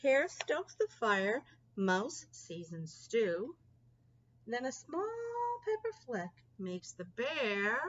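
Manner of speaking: ordinary delivery, nothing marked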